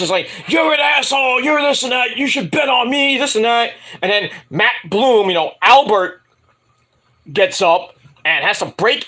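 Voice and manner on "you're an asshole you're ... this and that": high-pitched mock rant; mock yelling. Waller arguing with Shawn Michaels, mock angry yelling. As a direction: imitate a wrestler yelling, without much extra volume. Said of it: "Grayson Waller's yelling at Shawn Michaels".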